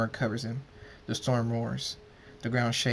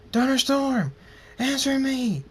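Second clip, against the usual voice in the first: high, loud, desperate call; desperate scream; 5 dB louder than usual. A narrator's reading. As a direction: scream for a reply from a fallen friend. Calling to a fallen friend.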